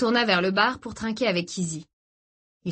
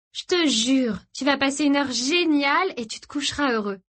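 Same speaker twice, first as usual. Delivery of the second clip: higher-pitched, emphatic coaxing; persuasive teenage girl; 7 semitones higher than usual. Acted. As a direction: promise him a wonderful time, pitched up. Narrator voices girl persuading Gray. (adolescente qui insiste avec enthousiasme)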